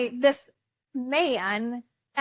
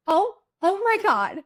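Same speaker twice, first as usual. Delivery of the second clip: higher retold gasp of disbelief; shocked exclamation; 7 semitones higher, 4 dB louder than usual. Bride seeing hands around her sister's neck, retold shock. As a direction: exclaim it high, reliving the shock.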